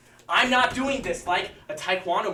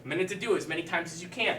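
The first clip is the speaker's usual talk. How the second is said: usual speaking voice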